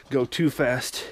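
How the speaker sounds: usual speaking voice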